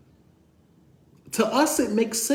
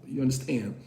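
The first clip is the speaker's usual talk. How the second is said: ordinary talk, nothing marked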